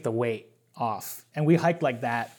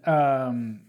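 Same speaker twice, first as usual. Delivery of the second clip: ordinary delivery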